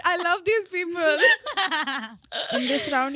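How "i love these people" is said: high, loud, laughing. Real, not acted. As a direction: gush through laughter. Host laughing with emotion.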